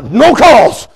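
loud, high retold bark; angry curt order. Pastor storming into his office, loud curt order. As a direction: bark it while storming past.

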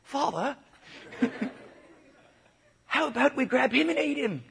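high British son's voice; British accent. Acted. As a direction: ask it eagerly in a high mock British accent. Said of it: "I'm sorry, well, he's British".

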